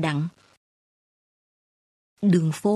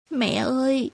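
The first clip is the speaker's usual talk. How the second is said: higher, choked cry for her mother; sobbing cry; 5 semitones higher than usual. A narrator's reading. Daughter runs to her sick mother's bed, sobbing cry. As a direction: call out through sobs. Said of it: "khóc nấc lên".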